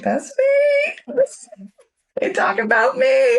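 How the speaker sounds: thrilled to be called the director; giddy excitement. Retold or imitated. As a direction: shriek of joy at hearing her title. Excited quote, overjoyed at being called director.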